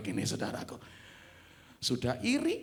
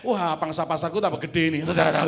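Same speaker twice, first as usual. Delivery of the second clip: gloating colleague; 9 dB louder than usual. Acted. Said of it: "Kalimatnya kayak me seneng".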